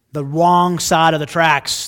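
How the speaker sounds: loud and slow, scornful. Retold or imitated. Preacher mocking the Pharisees, loud scornful sneer.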